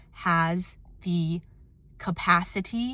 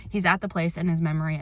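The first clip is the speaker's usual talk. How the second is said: ordinary talk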